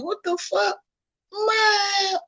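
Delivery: very high, soft falsetto exasperation; exasperated disbelief. Acted. Partner still doubted after going all in, exasperated. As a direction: squeak it out in mock disbelief. Acting out a fed-up reaction.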